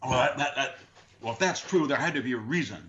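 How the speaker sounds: mock a flustered objector, stumbling at the start; retold protest with a touch of anger; imitated angry protest. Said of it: "They got angry".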